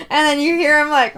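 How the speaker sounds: normal talking voice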